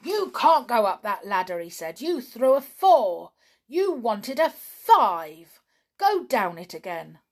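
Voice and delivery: cross. Acted. Donald crossly ordering Tom down the ladder, loud. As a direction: scold Tom for cheating. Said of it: "Donald was very cross".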